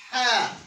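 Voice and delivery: Ha pushed out loud from the middle of the throat; teacher leads repetition of the throat sound. Shown as a demonstration. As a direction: call out Ha for the class to echo. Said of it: "So everybody after me".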